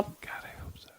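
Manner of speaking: breathy hushed hope; whispered. Real, not acted. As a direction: whisper it hopefully. Whispered reply.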